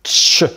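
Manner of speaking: tch as one sharp t-plus-sh sound. Shown as a demonstration. Teacher demonstrating Spanish CH, a clear tch. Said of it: "une prononciation comme on tch".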